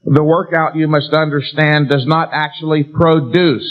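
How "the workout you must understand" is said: normal talking voice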